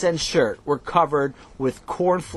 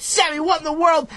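high, startled yelp of surprise; startled shout; 12 semitones higher than usual. A narrator's reading. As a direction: cry out in shock. Chaim startled by cold water.

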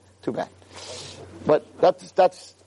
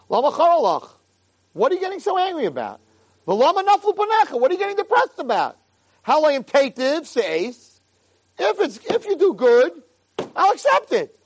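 God scolding; 8 semitones higher than usual. Acted. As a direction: rebuke Cain like a stern father, calling out. God scolds Cain, raised voice.

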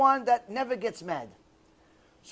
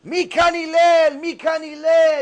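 high, loud, stretched-out holler; screaming caller; 6 semitones higher, 7 dB louder, slower than usual. Retold or imitated. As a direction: burst in yelling for him slowly. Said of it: "He's screaming like he never saw him before".

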